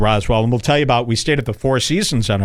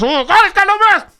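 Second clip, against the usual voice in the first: very high, loud hammed-up panic; mock terror; 4 dB louder than usual. Host reenacting his panic at the maskless man, high mock terror. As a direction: squeal it like you're horrified. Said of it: "I'm terrified".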